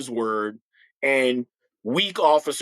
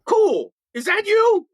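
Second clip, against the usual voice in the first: high, delighted "Cool. Is that you?"; light up with surprised delight; excited recognition; 4 dB louder, slower than usual. Retold or imitated. The man filming recognizes the officer, high delighted surprise. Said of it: "he goes".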